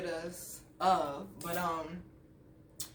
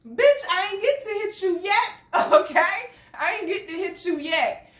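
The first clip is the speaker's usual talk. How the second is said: high, loud, exasperated protest; worked-up, high-pitched comeback; indignant retort; 9 semitones higher, 6 dB louder than usual. Retold or imitated. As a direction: throw it back at the accuser, voice raised.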